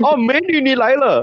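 high-pitched auntie voice in Chinese; auntie greeting. Retold or imitated. Drink store auntie greeting him in Chinese, gushing. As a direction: imitate the auntie calling out a greeting. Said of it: "she'll be like".

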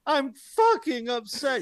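high, loud, slow cracking shout. Retold or imitated. Mocking the coach's speech, high slow cracking shout. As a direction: overdo the voice breaking on upset. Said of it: "His voice cracking during that speech".

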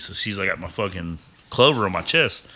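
usual speaking voice

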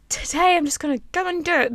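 usual speaking voice

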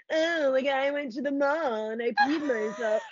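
put-on lightly stuck-up girl voice; snooty voice. Shown as a demonstration. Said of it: "like, a snooty voice".

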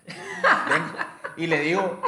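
ordinary talk, nothing marked

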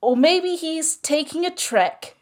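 loud, slow, declaiming each line; slam poetry. Acted. Performing a green-themed slam poem on the show, loud and slow. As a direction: perform it big like a slam poet.

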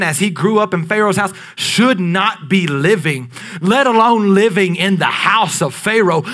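ordinary delivery

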